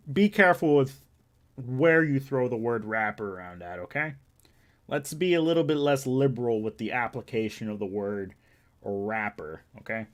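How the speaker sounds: loud, pointed reprimand, easing off at the end; mock stern warning. Streamer cautioning chat about the word rapper, mock stern. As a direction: hit the opening hard, like a lecture. Objects to calling him a rapper.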